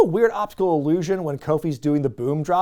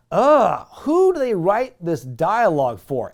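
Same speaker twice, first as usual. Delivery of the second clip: disgusted ugh; 4 semitones higher, 5 dB louder, slower than usual. Real, not acted. Disgusted at bad dialogue.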